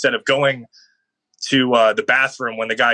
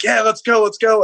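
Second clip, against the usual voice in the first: high, loud celebration shout; celebrating; 10 semitones higher, 4 dB louder than usual. Retold or imitated. Player sprinting downfield after a touchdown, high loud cheering. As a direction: yell it like you just scored. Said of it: "I'm like".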